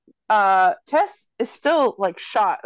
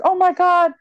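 high, louder imitation of amazed relief; mock relief; 8 semitones higher, 4 dB louder than usual. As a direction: act out exaggerated surprise and relief. Retold relief at seeing Tess alive.

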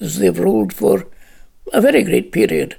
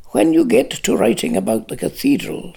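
cold; 8 semitones lower than usual. A narrator's reading. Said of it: "said Lanark coldly".

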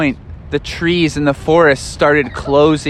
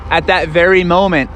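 usual speaking voice

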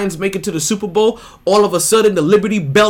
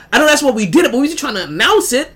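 usual speaking voice

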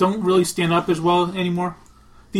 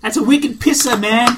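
loud, higher Boston townie bit; Boston accent; 4 semitones higher, 7 dB louder than usual. Acted. Townie bragging to his pal, loud Boston accent. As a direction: belt it out in a broad Boston accent. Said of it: "just do the accents again".

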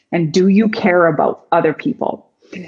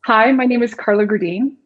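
slightly raised, tense introduction voice; nervously pitched-up greeting; nervous higher tone; 3 semitones higher than usual. Shown as a demonstration. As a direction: introduce yourself a little too high, as if nervous. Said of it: "speak in just a little bit of a higher tone".

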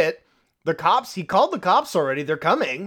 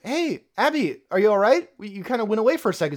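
mock concerned wife; 6 semitones higher, faster than usual. Wife voiced in film retelling.

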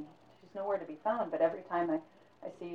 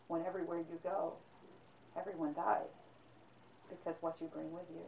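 much softer, sombre; grim musing, far softer; hushed reflection; 12 dB quieter than usual. A narrator's reading. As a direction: let it sink to near nothing.

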